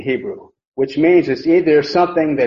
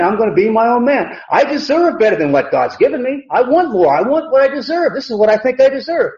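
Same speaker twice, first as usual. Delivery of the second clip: higher-pitched, insistent mock demands; acted self-entitlement; 6 semitones higher than usual. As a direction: act out a defiant, me-first tempter's boast. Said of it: "what Satan tries to tempt everybody with".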